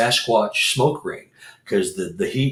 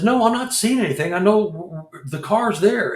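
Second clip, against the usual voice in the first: disbelieving alarm; 10 semitones higher than usual. Retold or imitated. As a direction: sound truly baffled the car moved. Insisting he sees it.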